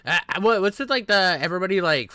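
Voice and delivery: ordinary talk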